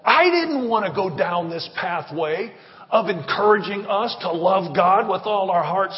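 ordinary talk, nothing marked

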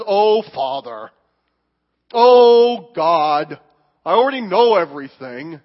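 put-on exasperated teen, dragging out "Oh"; eye-rolling adolescent. Sulky adolescent answering God, exasperated and dismissive. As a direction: groan it like a know-it-all teenager. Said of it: "the adolescent ... who says".

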